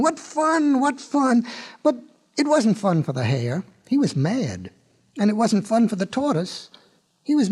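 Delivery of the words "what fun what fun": high-pitched gleeful cheer; excited mock yell. A narrator's reading. Crowd of animals delighted at the race, mild excited yell. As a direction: cheer it high without real volume. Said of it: "everyone yelling and screaming".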